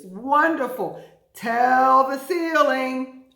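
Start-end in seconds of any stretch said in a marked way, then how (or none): 1.3-3.2 slow, loud call to the room. Shown as a demonstration. Teacher calls to the class to shout the word. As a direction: tell the ceiling, loud and slow. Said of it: "call to the class".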